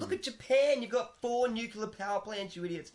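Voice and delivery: loud, high-pitched mock outrage; acted angry retort. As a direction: fire back as in a heated play-acted row. Acts out countries quarrelling, insults.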